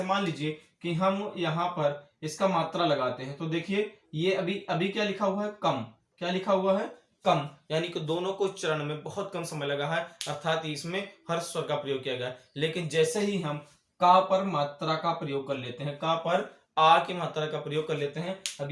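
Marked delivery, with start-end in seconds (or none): none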